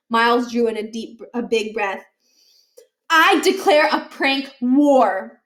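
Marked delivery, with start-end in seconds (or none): 3.1-5.4 slow, louder solemn announcement; boy declaring a prank war grandly; grand declaration. A narrator's reading. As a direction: proclaim it like a formal declaration. Miles announces war after deep breath.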